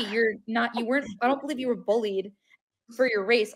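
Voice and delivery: ordinary delivery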